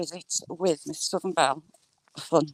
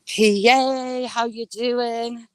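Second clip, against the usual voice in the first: drawn-out greeting; 3 semitones higher, 4 dB louder, much slower than usual. Real, not acted.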